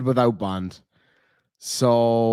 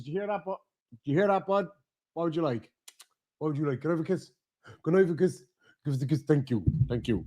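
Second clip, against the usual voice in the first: soft pet talk; 7 dB quieter, much faster than usual. Real, not acted. Streamer coaxing his puppy for a kiss, soft and sweet. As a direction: coo to the puppy like asking for a favour. Talking softly to his dog.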